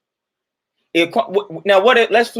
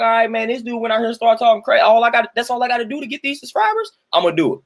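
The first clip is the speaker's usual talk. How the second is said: higher, rushing-to-cash-in youngster voice; eager young clout-chasers; 6 semitones higher than usual. Acted. Imitating youths after notoriety and subscribers, higher-pitched eager scheming. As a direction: pitch it up like kids chasing subscribers. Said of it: "So they like".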